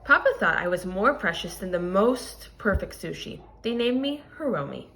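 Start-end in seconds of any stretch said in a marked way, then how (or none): none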